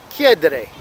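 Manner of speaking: "chiedere" with the emphasis on CHIE; stress on first syllable. Shown as a demonstration. Teacher listing ERE verbs, stressing CHIE in "chiedere". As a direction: hit CHIE at the start of "chiedere". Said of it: "falling on the beginning".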